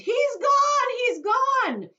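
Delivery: very high, loud panicked scream; mother screaming at the empty spot. Acted. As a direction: shriek in horror. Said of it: "and she screamed".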